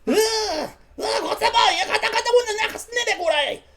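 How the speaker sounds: very high, squawky goblin gibberish. Acted. Biggest goblin cook greeting the newcomers, agitated goblin voice. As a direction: gabble like an agitated little goblin. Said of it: "kind of the biggest of the goblins".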